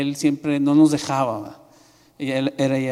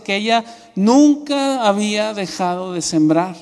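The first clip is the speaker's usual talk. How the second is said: "nunca" alargado y agudo, recalcado; énfasis en "nunca"; 6 semitones higher, 3 dB louder than usual. Retold or imitated. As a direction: recalca "nunca" despacio, con la voz arriba. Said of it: "estaba haciendo énfasis en eso". (con énfasis marcado en "nunca")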